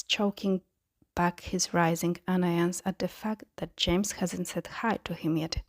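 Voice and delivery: ordinary delivery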